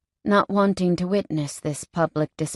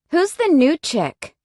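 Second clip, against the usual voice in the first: slow, much higher jealous sneer; biker woman voice; 9 semitones higher, 4 dB louder than usual. Acted. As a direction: drawl it out with a sneer. Brittany eyes newcomer.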